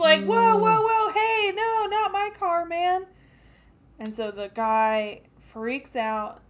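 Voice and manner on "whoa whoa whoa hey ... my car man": panic. Retold or imitated. Dude pleading as car is smashed.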